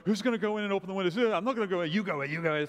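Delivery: fast, high, jostling voices; panicky girls. Retold or imitated. Rapid high girls arguing at the door. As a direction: girls pushing each other to go first. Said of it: "and they go".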